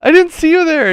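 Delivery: loud, high squealed apology; frightened barmaid. Acted. Barmaid apologising to an intimidating customer, frightened. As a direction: squeal in a frantic falsetto panic. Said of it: "And the barmaid's".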